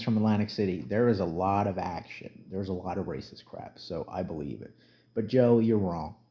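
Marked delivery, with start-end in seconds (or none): none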